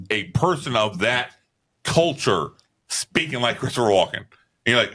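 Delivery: usual speaking voice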